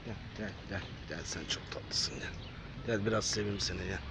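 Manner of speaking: very soft, low murmur to a cat; soft coaxing. Real, not acted. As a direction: coax a shy animal closer, almost whispering. Coaxing a small cat closer. (alçak sesle, usulca çağırarak)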